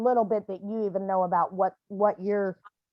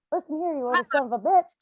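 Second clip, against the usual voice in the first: high, loud tongue-lashing at her son; a mother yelling at her dead son in the yard; angry scolding; 7 semitones higher, 5 dB louder than usual. Retold or imitated. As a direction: tear into him, furious and shrill. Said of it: "he got tongue lashings".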